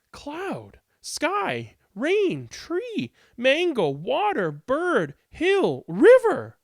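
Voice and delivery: high, punched words building to a loud finish; excited word list. Acted. Child proudly lists words grandmother reads.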